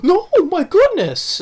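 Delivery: put-on high, slow squeaky old-lady gasp; shocked old lady. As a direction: mimic a flustered elderly woman. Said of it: "He's like".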